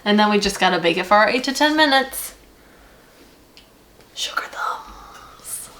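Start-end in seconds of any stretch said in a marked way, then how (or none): 4.1-5.7 hushed whisper. Acted. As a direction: breathe the words softly. Whispered label of cookies.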